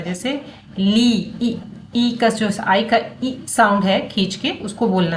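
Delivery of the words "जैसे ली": ली with its vowel drawn out long and slow; stretched ली for the class. Shown as a demonstration. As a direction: pull the vowel in ली out slowly. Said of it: "उसको खींच के बोलना होता है".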